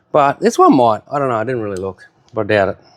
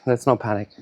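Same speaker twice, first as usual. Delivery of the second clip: softer "Let's not panic" to self; quiet self-calming; 2 semitones lower, 6 dB quieter than usual. Real, not acted. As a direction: murmur "Let's not panic" to calm yourself.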